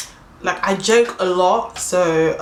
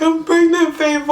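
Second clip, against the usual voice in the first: high, loud imitated wail; mock crying; 11 semitones higher, 5 dB louder than usual. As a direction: wail it to your friend, hammed-up. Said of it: "I'm start crying again".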